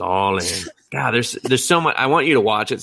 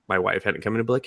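usual speaking voice

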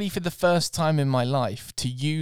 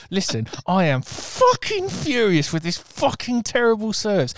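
raised, louder imitation of fury; an imagined furious rant, loud and pitched up; mock fury; 7 semitones higher, 4 dB louder than usual. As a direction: let the anger out that was held back. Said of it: "what I wanted to say was".

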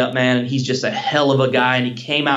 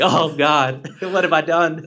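mock regret; 3 semitones higher, slower than usual. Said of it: "I was like".

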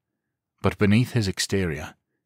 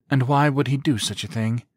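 playful; 7 semitones higher, 3 dB louder than usual. A narrator's reading. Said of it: "she asked playfully".

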